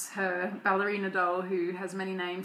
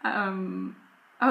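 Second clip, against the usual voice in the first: ordinary talk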